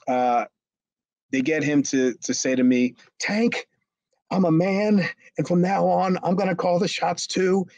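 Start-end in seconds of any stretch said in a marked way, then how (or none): 3.2-7.7 high-pitched imitation of standing up for oneself; mock self-assertion. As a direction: declare it stiffly, voice pitched up. Imitates goaded man asserting himself.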